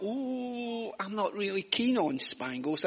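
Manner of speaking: soft, lightly acted "ooh" of reluctance; privately unimpressed little boy, imitated quietly; reluctant child's thought. Voices his childhood reluctant thought.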